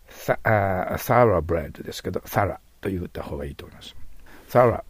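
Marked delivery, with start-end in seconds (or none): 4.5-4.8 "thorough" said as two short beats, "tha-ra"; thorough as "sara". Shown as a demonstration. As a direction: stress the first syllable of "thorough", ending in a short "ra". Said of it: "と聞こえますからね". (thorough を「サラ」のように発音)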